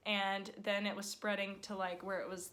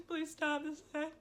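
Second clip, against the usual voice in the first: retold crying plea; 9 semitones higher than usual. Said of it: "was standing there crying".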